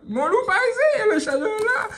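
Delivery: high cartoon voice for the charger; squeaky object voice. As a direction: squeak it like a tiny gadget.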